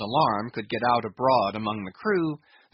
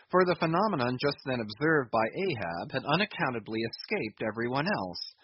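ordinary delivery

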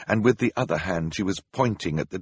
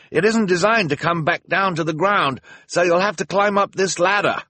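high, raised, projected voice; calling down; 10 semitones higher, 5 dB louder than usual. A narrator's reading. Hector to children beneath the ladder, calling down. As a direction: explain it loudly to people far below.

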